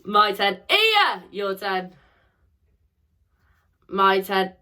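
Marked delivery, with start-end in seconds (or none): 0.7-1.2 loud high shout. Shown as a demonstration. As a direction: call the sound out loud. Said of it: "we'll shout for the last time".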